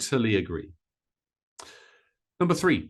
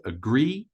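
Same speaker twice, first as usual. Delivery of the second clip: "agree" rising with weight on "gree"; second-syllable stress; 3 semitones higher than usual. Shown as a demonstration. Teacher showing learners word stress, "agree" stressed on "gree". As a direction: lean on the end of "agree". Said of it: "we stress the second syllable of this word".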